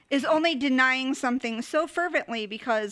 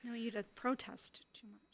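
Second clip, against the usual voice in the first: whispered mock Shakespeare line; breathy hushed correction; 14 dB quieter than usual. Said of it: "I'm just kidding".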